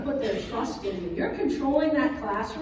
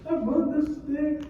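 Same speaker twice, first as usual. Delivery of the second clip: put-on higher, slow drawn-out child whine; whiny kid; 3 semitones higher, slower than usual. Son sulking in the car, slow and whiny. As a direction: stretch it like a sulky boy.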